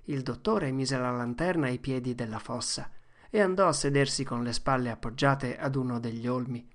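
usual speaking voice

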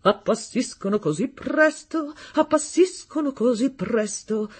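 high, drawn-out echo of her words; musing repetition at piano. A narrator's reading. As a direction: repeat it slowly, savouring the phrase. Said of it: "ripeté Reginaldo al pianoforte".

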